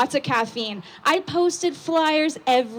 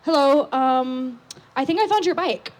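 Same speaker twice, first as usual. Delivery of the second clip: hesitant stranger; 4 semitones higher than usual. Retold or imitated. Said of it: "He said".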